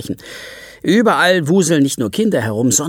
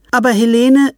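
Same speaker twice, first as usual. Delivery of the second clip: higher, louder protest; protesting mother; 10 semitones higher, 7 dB louder than usual. Acted. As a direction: start to object. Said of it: "fängt Helenes Mutter an".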